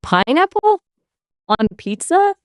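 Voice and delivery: high, incredulous question; shocked disbelief. Acted. AI hearing pineapple suggested, shocked disbelief. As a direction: react as if the idea is outrageous.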